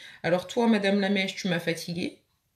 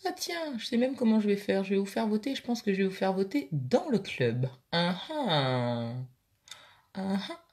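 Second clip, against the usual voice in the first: muffled through closed lips; talking with mouth closed; 2 semitones lower, 5 dB quieter than usual. Real, not acted. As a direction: keep lips shut while talking. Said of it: "Mince, j'ai ouvert la bouche".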